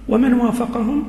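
ordinary talk, nothing marked

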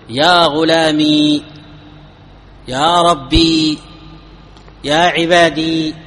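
يا غلامي slowly, holding the long final ya; very slow demo that keeps the ya sound; ya kept. Shown as a demonstration. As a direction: draw out the final ya of غلامي and عبادي. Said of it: "إثبات الياء".

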